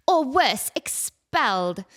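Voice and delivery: light British schoolgirl read; prim British Hermione reading to a child; mild Hermione impression, slowing on expelled. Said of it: "Do the Hermione voice".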